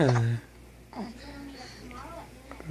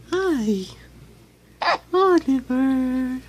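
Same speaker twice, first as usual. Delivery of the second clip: high excited call; 10 semitones higher, 3 dB louder than usual. Real, not acted. Calling the baby excitedly.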